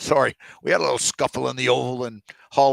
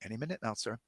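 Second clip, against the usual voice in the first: ordinary talk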